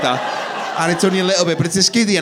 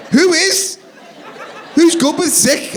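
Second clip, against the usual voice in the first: incredulous; 12 semitones higher, slower than usual. Acted. Husband answering wife's excuse about sick, incredulous. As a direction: throw it back, high and exasperated.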